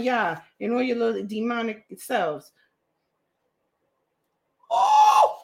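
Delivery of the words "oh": a sudden high, louder oh. Real, not acted. Vlogger finding bashfulness listed, high exclamation. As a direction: spot the next entry with a yelp.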